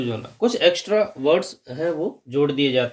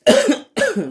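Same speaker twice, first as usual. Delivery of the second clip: sudden sharp cough; real cough mid-lesson; 6 dB louder than usual. Real, not acted. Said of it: "sorry". (अचानक खाँसी)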